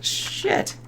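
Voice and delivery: breathy whispered shit; whispered curse. Real, not acted. Viewer reacting to the robot damage, whispered curse. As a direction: hiss it under your breath.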